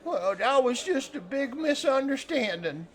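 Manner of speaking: high-pitched simple fellow; eager fish-camp hand. Acted. Game master plays Cletus.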